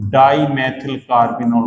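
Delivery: dimethyl carbinol spelled out slowly, each part drawn out; teacher slows the name so students can follow; slow naming. Shown as a demonstration. Teacher spelling out a chemical name for the class, slowly. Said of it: "तो इसका नाम क्या हो जाएगा?".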